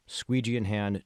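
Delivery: usual speaking voice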